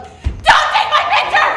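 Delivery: high, very loud, drawn-out shout; explosive outburst. Retold or imitated. Daughter to mom who snapped her picture, explosive shout. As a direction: explode at full volume, stretching the words. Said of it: "I just explode".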